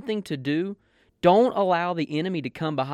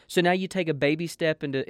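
ordinary talk, nothing marked

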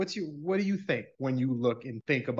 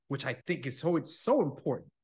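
normal talking voice

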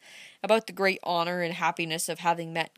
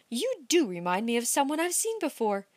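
a touch higher, musing; Amy recalling a face, lightly higher; young woman's voice; 5 semitones higher than usual. A narrator's reading.

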